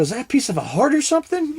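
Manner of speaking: very high-pitched, innocent-sounding question; feigned wonder. Retold or imitated. Hunter teasing buddy over a heart piece, feigned wonder. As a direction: play dumb, as if amazed by the find.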